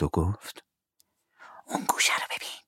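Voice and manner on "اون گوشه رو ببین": unvoiced whisper. Tom points in the cave, whispering. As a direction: keep it secret.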